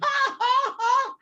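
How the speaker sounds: very high rising shriek of laughter; whooping laugh. Pretend. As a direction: burst into a wild whoop.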